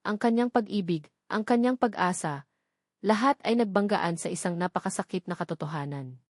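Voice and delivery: ordinary delivery, nothing marked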